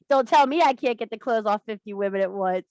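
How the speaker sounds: ordinary talk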